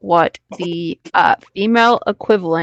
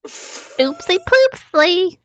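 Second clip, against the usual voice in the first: put-on babyish singsong; 10 semitones higher, much slower than usual.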